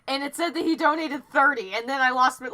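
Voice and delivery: ordinary talk, nothing marked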